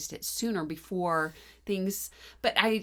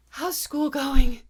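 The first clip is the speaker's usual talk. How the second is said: out of breath; 5 semitones higher, 7 dB louder, slower than usual. Retold or imitated. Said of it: "Probably 'cause I couldn't talk".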